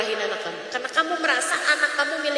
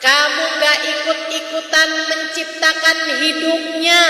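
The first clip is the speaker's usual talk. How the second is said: forceful preaching; 3 semitones higher, 5 dB louder than usual. Acted. Preacher rebuking mothers, loud and rising. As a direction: hammer each line home to the mothers.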